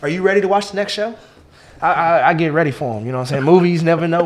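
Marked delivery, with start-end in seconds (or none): 0.0-1.1 rehearsed shout-out. Shown as a demonstration. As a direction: announce it like a network host. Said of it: "I rehearse my shout-outs".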